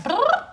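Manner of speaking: high loud trilled brrr; boyfriend's dismissive brrr; mock grumble. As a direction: blow out a mocking raspberry sound. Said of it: "mumble something in Sicilian dialect".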